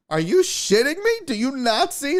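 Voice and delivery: high mock outrage; mock disbelief at the clerk; lady in front. Imitating the woman in front protesting, mock outrage. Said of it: "she was like".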